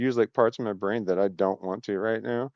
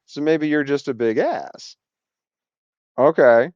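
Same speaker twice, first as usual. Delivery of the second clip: ordinary talk, nothing marked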